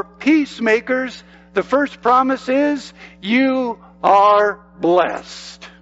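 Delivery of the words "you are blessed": each word drawn out very slowly; slow emphatic blessing. Acted. Quoting the promise to listeners.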